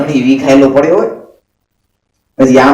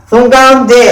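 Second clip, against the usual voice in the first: friend calling out; 8 semitones higher than usual. Retold or imitated. Friend answers police from inside.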